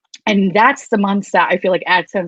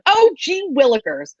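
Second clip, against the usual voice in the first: put-on higher, slow wholesome kid-show voice; mock goody-goody; 7 semitones higher than usual. To fans, mocking a censored cheerful YouTuber. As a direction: pretend to be squeaky clean for advertisers.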